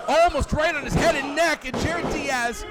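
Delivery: rising to a loud excited yell; excited shout. Real, not acted. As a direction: erupt as the pin nearly lands. Commentator erupts at near pin.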